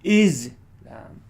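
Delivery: "Islam" as a loud "IZ", then a falling "lam"; "IZ-lam", loud front, weak end; first-syllable stress. Shown as a demonstration. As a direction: hit the first syllable of "Islam" hard, drop the second. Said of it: "Or you can go".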